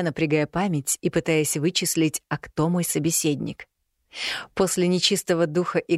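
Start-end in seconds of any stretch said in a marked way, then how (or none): none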